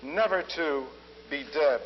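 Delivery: lyrical recitation of his own verse; poem reading. Acted. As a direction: recite it as a poem to a full hall. Reads his own poem aloud.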